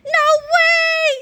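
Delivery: high, drawn-out excited scream. A narrator's reading. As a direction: scream in thrilled disbelief. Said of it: "screamed George".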